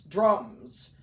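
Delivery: ordinary delivery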